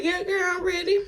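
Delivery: high, sassy fake confidence; confident stage persona. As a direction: put on the bold dancer girl. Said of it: "I had to get my diamond on".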